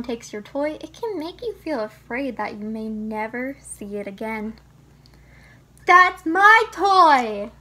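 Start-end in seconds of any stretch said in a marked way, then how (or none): 5.8-7.5 loud, high, drawn-out angry shout; angry yell. Acted. Child whose toy was taken, angry shouting. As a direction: yell it slowly, pounding each word. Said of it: "stomping your feet or growling".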